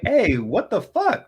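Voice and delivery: ordinary talk, nothing marked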